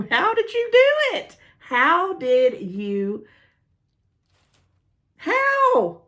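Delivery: high squealed disbelief, slowing down; real squeal. Real, not acted. To the friend who found it, squealing in amazement. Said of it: "now that I've squealed".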